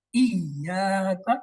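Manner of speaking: ای یا کا drawn out with the doubled ya clear; slow shadda. Shown as a demonstration. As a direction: say ای یا کا slowly. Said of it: "اس کی دو یا بن گئی".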